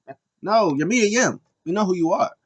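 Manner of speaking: high, loud exasperated protest; exasperated retort. Pretend. Fan to the unmasked wrestler, loudly exasperated. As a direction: call out the obvious pretence. Scolding the wrestler over her disguise.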